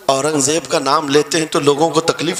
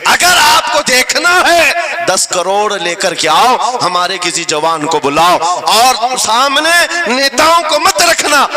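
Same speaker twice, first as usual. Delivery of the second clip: loud, high rally oratory; impassioned sermon at full force; fiery preacher; 7 semitones higher, 4 dB louder than usual. Real, not acted. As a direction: hurl it at the crowd.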